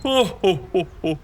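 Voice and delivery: a fond little tut-tut; amused oh oh. Acted. Ornithologist indulging Mike's idea, amused oh oh.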